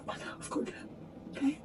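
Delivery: whispered I've got to; whispered resolve. Real, not acted. Throwing away beloved jeans, whispered resolve. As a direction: whisper it to steel yourself. Forcing herself to bin jeans.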